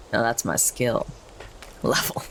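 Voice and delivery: normal talking voice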